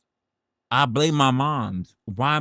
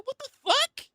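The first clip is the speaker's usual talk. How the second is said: high, startled outcry; imitating own stunned reaction; retold shock. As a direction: replay the shock of seeing deleted messages. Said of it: "I said".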